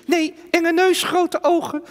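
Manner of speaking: high, scared puppet voice; nervous ventriloquist doll; talking doll. Acted. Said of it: "Henk is mijn pratende pop".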